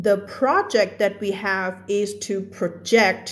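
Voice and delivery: first "project" as PRO-ject, last "project" as pro-JECT; noun versus verb stress. Shown as a demonstration. Teacher's example sentence, "project" stressed PRO, then JECT. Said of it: "คำนี้ทำหน้าที่เป็น verb".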